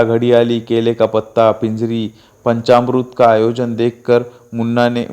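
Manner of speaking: ordinary delivery, nothing marked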